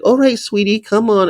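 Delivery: put-on high baby-talk "sweetie" to a dog; sweet dog-handler voice. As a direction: coax the dog like a sugary animal lady. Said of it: "she's like".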